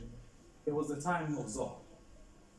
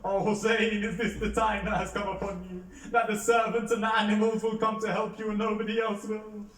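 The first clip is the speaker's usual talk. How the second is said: loud, high anguished wail; raised, grieving lament; crying out; 8 semitones higher, 8 dB louder than usual. A narrator's reading. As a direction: cry it out to Hussein in grief. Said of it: "he cries out".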